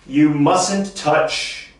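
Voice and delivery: You mustn't touch, very slow, mustn't weighted; slow model sentence. Shown as a demonstration. Teacher modelling slowly for students to repeat. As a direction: draw it out for the class. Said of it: "Everybody say that".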